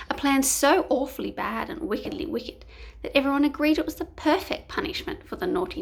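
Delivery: plain reading voice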